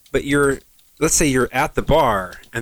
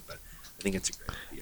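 whispered aside; 18 dB quieter, much faster than usual. Real, not acted. Aside after criticising the idea.

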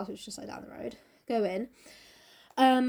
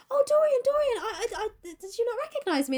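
hurt Basil; 11 semitones higher than usual. Retold or imitated.

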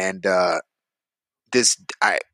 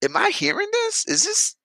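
retold disbelief; 7 semitones higher than usual. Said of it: "and I'm thinking".

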